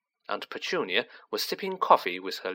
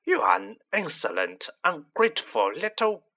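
higher-pitched furious scolding; 7 semitones higher than usual. A narrator's reading. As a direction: scold with rising fury, each insult pitched up. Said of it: "swelling with fury".

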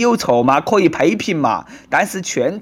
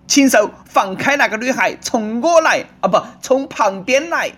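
high-pitched, hammed-up rescuer; mock hero; 6 semitones higher than usual. Bystander confronting a man grabbing a schoolgirl, mock-heroic. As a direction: challenge the villain in play-acted alarm.

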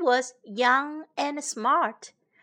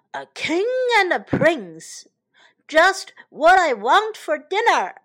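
a breathy lion's purr that grows to a louder, higher roar; hungry lion; 4 semitones higher, 6 dB louder than usual. Acted. Lion springing at the king and prince, gloating roar. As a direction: gloat over the meal, then roar. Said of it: "roared the lion".

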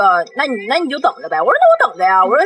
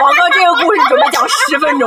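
laughing; 5 semitones higher, 3 dB louder than usual. Real, not acted. Self-mocking long story.

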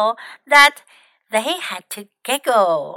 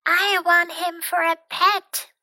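small, higher-pitched voice; very little voice; 5 semitones higher than usual. A narrator's reading. Pinky asking for a pet, very little voice. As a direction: make the voice tiny and high. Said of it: "said in a very little voice".

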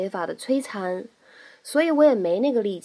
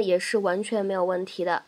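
ordinary delivery

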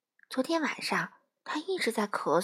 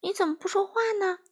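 higher, lightly acted puzzled question; surprised; 10 semitones higher than usual. A narrator's reading. Duck asks silent Frog a question, mildly surprised. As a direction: ask with mild storybook surprise. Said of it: "他惊讶地问".

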